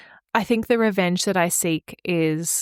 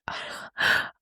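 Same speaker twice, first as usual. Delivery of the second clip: whispered gasp; 5 dB quieter than usual. Retold or imitated. Shocked reaction retold.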